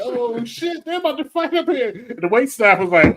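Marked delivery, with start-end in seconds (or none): 0.0-1.9 high-pitched excited retold outburst; mock alarm. Diner telling friends a fight is starting, mock alarm. Retelling his own alarmed reaction.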